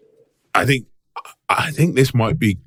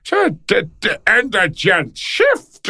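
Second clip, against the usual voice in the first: Irish lilt pitched way up, slow; mock Irish accent; 12 semitones higher, slower than usual. Host mimics Connery's Irish accent in high mock lilt. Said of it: "there's a definite".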